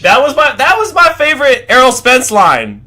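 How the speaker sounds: high, loud excited gush. Real, not acted. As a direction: hype up your favorite line. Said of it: "excited about favorite line".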